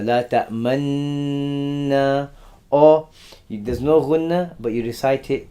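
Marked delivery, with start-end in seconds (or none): 0.0-2.3 La ta'manna very slow with a long nasal hum on the nun; with ghunnah. Shown as a demonstration. Teacher demonstrates La ta'manna recited with ghunnah. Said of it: "Or this is with a gunna".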